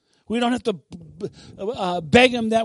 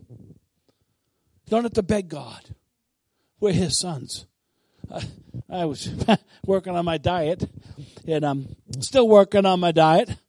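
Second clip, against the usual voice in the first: ordinary talk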